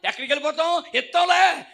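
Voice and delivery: high, shouted comeback from a debtor; loud indignant retort. Acted. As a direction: snap back at full volume. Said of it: "పుచ్చుకునేటప్పుడు ఉన్న స్వరం కాదు అది". (గట్టిగా అరుస్తూ ఎదురు తిరగడం)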